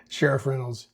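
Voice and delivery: ordinary talk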